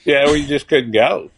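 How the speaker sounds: usual speaking voice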